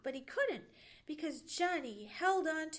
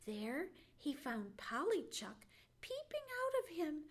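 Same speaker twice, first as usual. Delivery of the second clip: ordinary delivery, nothing marked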